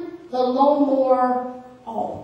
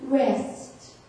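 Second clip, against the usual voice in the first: soft divine word; 4 semitones lower, 9 dB quieter than usual. Retold or imitated. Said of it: "the Lord spoke to me and he said".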